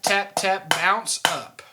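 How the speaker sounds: tap, tap, bounce up drawn out slowly; slow demo count. Shown as a demonstration. Drum teacher demonstrating the accent bounce slowly to students. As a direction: lay out each stroke slowly. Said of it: "we want it to bounce up".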